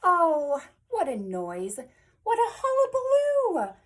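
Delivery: loud, theatrical dismay, swooping high; exclaiming at the din. A narrator's reading. Reader reacts to noisy animals, loud exclamation. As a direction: cry out over all the racket.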